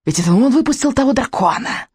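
high, gleefully spiteful accusation; gloating witch. A narrator's reading. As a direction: relish telling on him, pitch climbing. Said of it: "злорадно добавила она".

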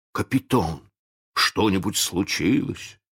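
plain reading voice

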